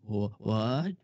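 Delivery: rising what?; mild mock shock; stunned disbelief. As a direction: caught off guard. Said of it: "I'm like".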